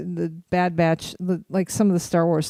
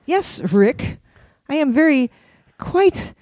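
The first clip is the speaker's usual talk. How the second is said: put-on mid-Atlantic accent, pitched higher and louder; mock mid-Atlantic accent; 7 semitones higher, 4 dB louder, much slower than usual. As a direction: play a hammed-up old-Hollywood mid-Atlantic accent.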